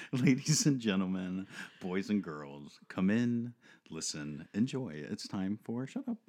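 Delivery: showman intro, loud bursts; grand entrance announcement. Acted. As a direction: call them in like a ringmaster.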